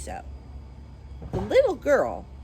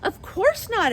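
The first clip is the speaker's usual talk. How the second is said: put-on high, louder child's voice; high girlish answer; 13 semitones higher, 5 dB louder than usual. Dorothy answers the melting witch in a high child's voice. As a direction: answer like a little girl. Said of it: "answered Dorothy".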